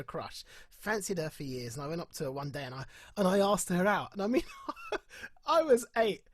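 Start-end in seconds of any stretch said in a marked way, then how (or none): 4.5-5.4 high burst of laughter; real amused laugh. Real, not acted. Laughing at own childhood story.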